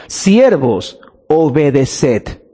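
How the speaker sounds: drawn out very slowly, pitched low; deliberate, weighty imperative; solemn command. Real, not acted. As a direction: lay the word down slowly and firmly. Quotes the command for emphasis.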